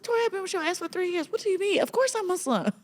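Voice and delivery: how could you not know; indignant disbelief. Retold or imitated. Client protests to her therapist, high incredulous voice.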